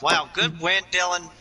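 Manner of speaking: ordinary talk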